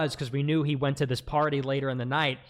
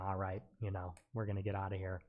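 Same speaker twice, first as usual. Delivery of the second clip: much softer and lower, low-key; retold quiet brush-off, softer; calm exit line; 9 semitones lower, 13 dB quieter, faster than usual. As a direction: drop it down and let it go quietly. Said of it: "and I said".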